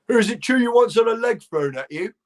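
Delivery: mock heckler. An audience member teasing the comic, high mock heckler voice. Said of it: "audience went".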